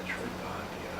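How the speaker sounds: breathy whispered Metroidvania; sensual whisper. Pretend. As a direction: whisper it seductively. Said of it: "sounds kinda sensual almost".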